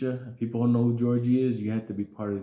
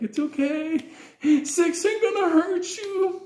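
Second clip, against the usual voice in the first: very high, slow falsetto coo; mock soothing mom; 5 dB louder, slower than usual. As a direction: mimic the mother calming her son. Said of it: "rubbing him".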